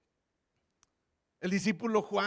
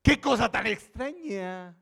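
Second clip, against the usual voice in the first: amazed exclamation; 4 semitones higher, 5 dB louder, slower than usual. Acted. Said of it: "signos de admiración".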